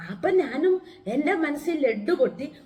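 ordinary talk

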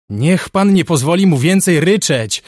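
wounded man crying out from across the ward; desperate angry call. Acted. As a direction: call out in despair and anger, high and loud. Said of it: "zawołał z daleka ranny z rozpaczą i gniewem".

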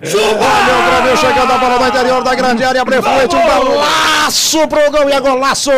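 loud rising goal call; explosive goal commentary. Real, not acted. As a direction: shout it as the ball goes in.

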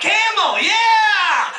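high, whooping celebration over a win; cheering high for cigarettes you won; giddy cheer. Retold or imitated. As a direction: shout it like you just hit the jackpot.

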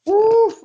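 loud, high whew of relief; relieved whew. Real, not acted. Player escapes being eaten, relieved whew. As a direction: let out a big relieved whew.

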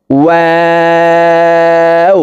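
"ওয়াও" with round lips, held very long on a high level tone; round-lipped, stretched waw. Shown as a demonstration. Teacher demonstrating waw, long round-lipped hold. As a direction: draw out "ওয়াও" as one steady chanted note. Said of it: "চার আলিফ টেনে পড়তে হবে".

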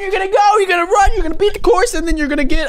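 high, loud superfan shouting; over-the-top cheering. Pretend. Cheering on his opponent.

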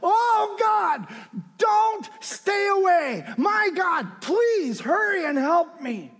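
high, loud pleading cry; high, loud plea for help; crying out to God. Retold or imitated. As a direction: cry out to God, begging him to hurry. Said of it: "he cries out there".

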